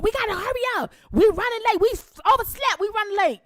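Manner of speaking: high urgent auntie voice; panicked auntie. Retold or imitated. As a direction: mimic auntie hurrying everyone. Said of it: "my auntie banging on the door".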